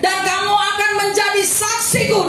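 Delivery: high, sustained fiery proclamation; full-force preaching. Real, not acted. Preacher urging the congregation to spread the Gospel, full-force. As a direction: proclaim it with burning zeal, pitched high.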